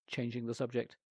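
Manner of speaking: usual speaking voice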